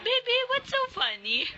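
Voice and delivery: very high sing-song question. Real, not acted. Mother asking her laughing baby, very high baby talk.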